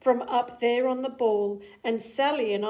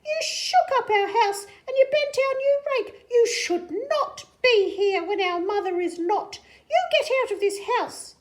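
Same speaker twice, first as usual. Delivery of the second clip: high, loud, scolding fish with a laugh; squeaky outraged scolding; fish character voice; 7 dB louder than usual. Acted. Fish orders the cat out of the house, high and loud. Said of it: "said the fish in the pot".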